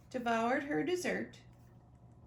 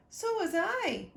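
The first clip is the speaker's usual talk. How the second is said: Trixie answering higher and louder; higher child voice; 8 semitones higher, 5 dB louder than usual. Acted. Trixie agreeing with Sonia in a higher child voice. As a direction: pipe up like a small girl. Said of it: "Trixie replied".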